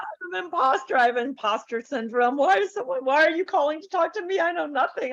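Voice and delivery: high, small, self-doubting inner voice; a timid little girl's worried self-talk; inner critic voice. Retold or imitated. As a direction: play the anxious impostor in your head. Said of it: "I start to go into".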